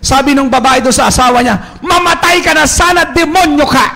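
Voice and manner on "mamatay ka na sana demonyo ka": angry curse. Retold or imitated. Quarrelling wife curses husband.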